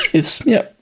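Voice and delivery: misstrauisch, deutlich höher; ungläubig nachfragend, hoch; verletzter Mann. Acted. (misstrauisch und höher)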